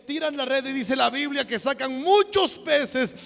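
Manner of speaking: loud, high, fervent sermon delivery; impassioned pulpit cry; full-force preaching. Acted. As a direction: proclaim it to the whole congregation, stretching key words.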